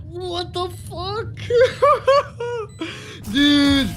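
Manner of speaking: shocked slow curse breaking into laughter; stunned disbelief. Real, not acted. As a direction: lose it in disbelief, then laugh. Co-caster stunned by clutch.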